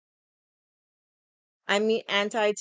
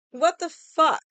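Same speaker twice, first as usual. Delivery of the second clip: ordinary talk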